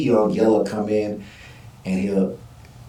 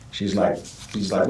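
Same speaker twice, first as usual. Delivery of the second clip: normal talking voice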